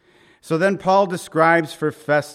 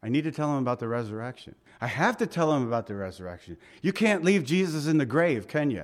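fast urgent reminder; faster than usual. Retold or imitated. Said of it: "Paul was constantly reminded".

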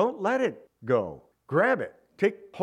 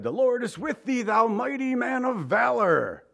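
voice of God; 7 semitones higher than usual. Acted. God hails timid Gideon in the cave, grand proclamation. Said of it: "God came to him and said".

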